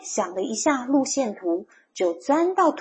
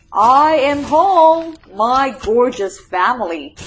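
loud, drawn-out homecoming call; 3 semitones higher, 9 dB louder, slower than usual. A narrator's reading. Mole greeting his gorgeous family, calling out. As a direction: announce yourself grandly to the household.

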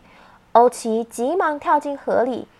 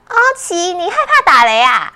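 put-on child voice; 6 semitones higher, 9 dB louder, faster than usual. Jisaiya asks Ouqi.

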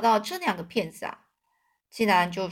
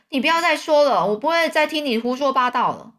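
higher and louder, furious cut-off; angry shout; 5 semitones higher, 5 dB louder than usual. A narrator's reading. Duke silencing the king, furious shout. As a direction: shout him down.

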